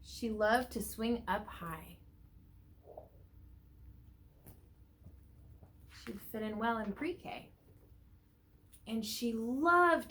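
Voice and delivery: slow, expressive storybook reading; reading to children. A narrator's reading. Teacher reading a picture book to the class, lively storybook voice. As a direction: read it out to a class of little ones.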